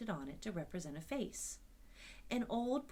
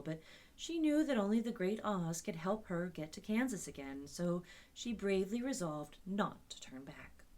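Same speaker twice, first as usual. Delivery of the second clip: plain reading voice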